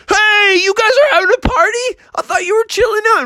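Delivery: very high, boisterous bull; surprised bull. Acted. Benny the Bull returns to a party, surprised. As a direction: exclaim it high in disbelief. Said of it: "He's like".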